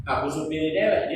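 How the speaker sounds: ordinary delivery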